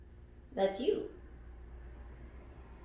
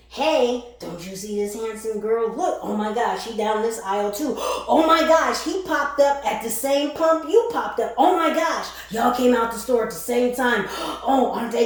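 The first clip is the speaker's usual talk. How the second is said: hammed-up gushing matchmaker voice; mock gushing matchmaker; 2 semitones higher, 7 dB louder than usual. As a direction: play the pushy matchmaker shoving a date at you, all gasps. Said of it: "Like, mock matchmaker voice".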